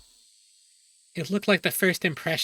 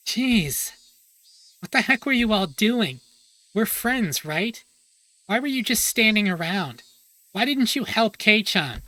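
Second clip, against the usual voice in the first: put-on higher, scolding girl; angry Mion; 5 semitones higher, 4 dB louder than usual. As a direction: tear into the classmates, higher.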